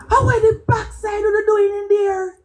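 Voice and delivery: auntie yelling. Retold or imitated. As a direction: call them out of the river like a furious aunt. Auntie Myrtle yelling from riverbank.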